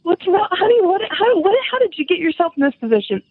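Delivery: shrill worried wife calling out; high alarmed calling out. Retold or imitated. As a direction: voice jumps high, frantic. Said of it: "and I'm going".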